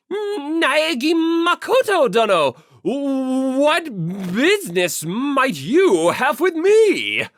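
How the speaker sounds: overly formal and flustered; pompous otaku. Acted. Yamada answering Naegi from the computer, loud pompous otaku voice.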